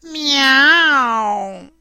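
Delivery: long, stretched-out miaow; yawning cat meow. A narrator's reading. Tired skinny cat indifferent to humans, yawned meow. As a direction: meow through a big lazy yawn. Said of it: "sbadigliò un gatto secco e stanco".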